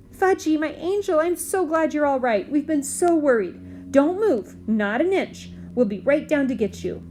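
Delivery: a mother's relief, lightly voiced; relieved mother. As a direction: pour out relief to your lost child. Mom relieved on phone.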